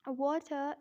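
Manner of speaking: British "Water" with a crisp T and no R; British-accent demo of Water. Shown as a demonstration. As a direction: say Water the British way, schwa ending. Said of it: "in British accent".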